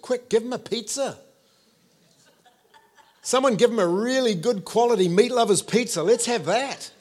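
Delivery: high, excited mock demand; eager crowd member. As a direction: call out hungrily, hamming it up. Voices hungry crowd member ordering pizza.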